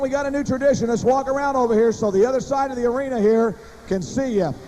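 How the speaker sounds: usual speaking voice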